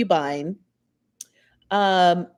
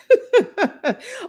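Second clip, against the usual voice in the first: sudden high laugh at a funny comment; burst of laughter; 6 dB louder than usual. Real, not acted. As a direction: laugh out loud, high and bright.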